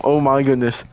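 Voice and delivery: normal talking voice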